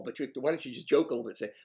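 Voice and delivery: ordinary talk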